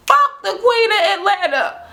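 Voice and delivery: high-pitched mock defiance; retold insult, pitched high; defiant retort. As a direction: imitate a scornful clapback to a diva. Acted retort to the boast.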